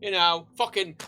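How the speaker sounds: usual speaking voice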